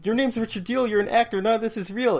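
high, urgent pleading; desperate plea. Retold or imitated. Abby pleading with the man holding the knife, high and urgent. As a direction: beg him to snap out of it, pitched high.

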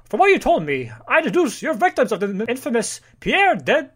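heroic Mountie voice. Acted. Reading the Mountie's lines aloud.

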